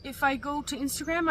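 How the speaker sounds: ordinary talk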